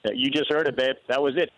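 ordinary delivery

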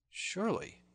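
high, wondering murmur; to himself. A narrator's reading. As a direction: puzzle it out privately. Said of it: "quoth he to himself".

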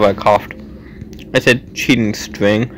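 usual speaking voice

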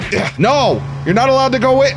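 high, loud burst of protest; protesting shout. Pretend. Clerk stops a customer leaving with goods, protesting shout. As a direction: yell it after him, pitch leaping up.

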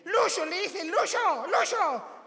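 high, loud mock woman's voice calling a man's name. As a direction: call out urgently as the woman in the tree scene. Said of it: "Y llega, le dice".

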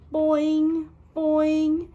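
high loud bouncy boing; springy leaping boings; boing sound. A narrator's reading. Hare leaping sound effect.

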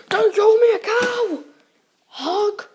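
loud, high, slow furious retort; outraged shout. Pretend. Peach shouting back at the bodyguard who called her a cow, furious. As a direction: bark it back at the insult.

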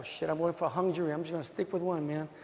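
low, soft mock mutter; muttering juror. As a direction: grumble to yourself like a stubborn juror. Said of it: "those terrible jurors".

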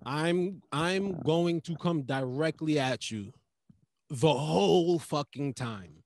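slow, deliberate imitation of a head-on comic; Dante's confrontational style. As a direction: stretch the words out, coming straight at them. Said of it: "Dante's style is like".

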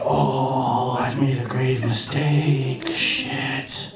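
slow, drawn-out realisation; dawning regret. Retold or imitated. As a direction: let the blunder sink in slowly. Dawning horror at his own blunder.

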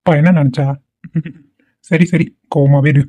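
ordinary talk, nothing marked

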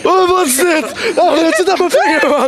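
high, laughing freak-out; alarmed disgust. Real, not acted. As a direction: squeal as something sticks to your finger. Contestant disgusted by thing on finger.

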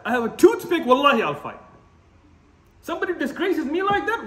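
normal talking voice

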